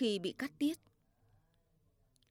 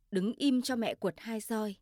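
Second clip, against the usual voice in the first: ordinary delivery, nothing marked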